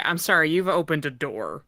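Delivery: ordinary delivery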